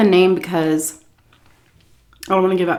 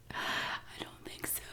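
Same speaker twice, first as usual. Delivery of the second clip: whisper; 24 dB quieter than usual. Real, not acted. Aside answering his own question.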